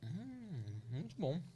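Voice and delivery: chewing while talking; mouth full. Real, not acted. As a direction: speak through a bite of coxinha. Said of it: "O barulho da coxinha".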